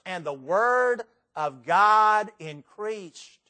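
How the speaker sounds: deliberate, drawn-out verse reading; slow, weighty emphasis. Shown as a demonstration. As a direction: stretch out "the word of God increased" slowly. Reads verse slowly, then repeats it.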